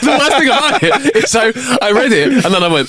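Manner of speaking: high, helpless laughing speech; words squeezed out through laughter, very high. Real, not acted. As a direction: tell it while cracking up. Cracking up after the punchline.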